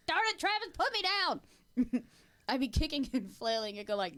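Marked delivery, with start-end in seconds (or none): 0.1-2.0 high, slow, whiny protest; high whiny hammed-up protest; mock protest. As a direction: squirm and demand to be put down. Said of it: "I'd go like".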